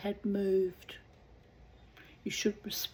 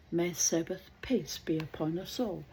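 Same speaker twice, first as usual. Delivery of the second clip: plain reading voice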